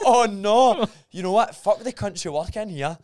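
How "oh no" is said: loud, high laughing cry; laughing disbelief, much higher and louder. Real, not acted. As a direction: burst out laughing on it. Laughing at toilet story.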